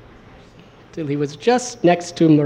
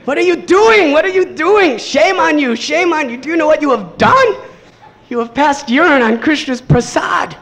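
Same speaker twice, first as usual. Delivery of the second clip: high, loud scolding cry; outraged shout; 11 semitones higher, 6 dB louder than usual. Retold or imitated. Murari shouting at Nimai over the ruined prasad, high and loud. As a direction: shame him for what he did.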